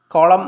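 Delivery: കോളം ending on M, no N sound; silent N. Shown as a demonstration. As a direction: say കോളം with the final N left silent. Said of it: "ന sound ഇല്ല". (കോളം, ന ശബ്ദം ഇല്ലാതെ)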